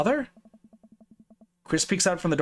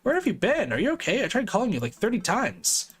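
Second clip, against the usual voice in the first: higher, rushed worried daughter voice; worried teenage daughter; 7 semitones higher than usual. Acted. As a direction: pour out worried questions as the daughter.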